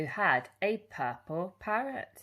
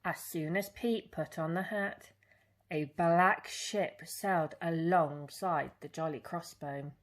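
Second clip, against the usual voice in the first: ordinary delivery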